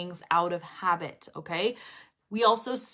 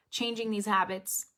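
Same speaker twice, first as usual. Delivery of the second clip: ordinary delivery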